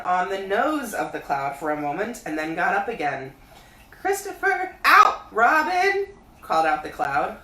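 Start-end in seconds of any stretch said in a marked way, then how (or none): 3.9-6.1 high, loud bear calling down; Pooh voice. A narrator's reading. Pooh calling down to Christopher Robin, high bear voice. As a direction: call up from the honey tree like a bear. Cloud calls down.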